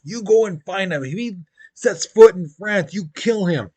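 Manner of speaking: mock-stern command to troops; acted royal command. Acted king ordering his soldiers.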